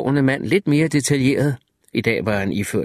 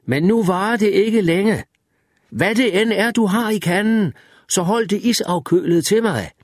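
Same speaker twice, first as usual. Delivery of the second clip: calling out; 8 semitones higher than usual. A narrator's reading. Narrator keeps calling to Wireman.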